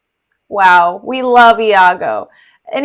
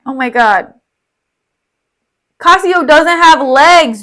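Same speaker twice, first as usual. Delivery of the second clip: ordinary delivery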